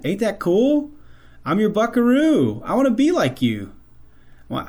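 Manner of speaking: put-on high, eager kid voice, pitched well up; little boy voice. Dad retelling his son's words from a country song, high childlike voice. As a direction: play the proud little buckaroo copying his dad.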